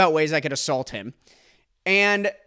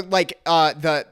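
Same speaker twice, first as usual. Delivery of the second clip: ordinary talk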